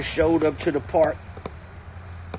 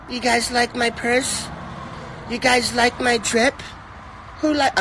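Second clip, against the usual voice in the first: mock [name] voice; 10 semitones higher than usual. Comedian mocking a rapper's onstage banter, high falsetto. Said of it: "trying to sound like [name]".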